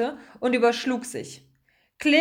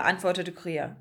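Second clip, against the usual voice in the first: usual speaking voice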